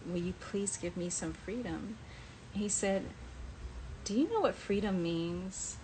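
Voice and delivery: normal talking voice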